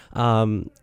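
usual speaking voice

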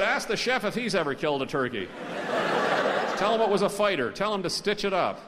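high, emphatic comeback; hammed-up, indignant retort; defiant bluster. A narrator's reading. Dave blusters back at the manager.

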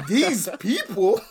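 high, loud laughing disbelief; incredulous. Real, not acted. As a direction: laugh it out, half offended. Mock offense at a friend's remark.

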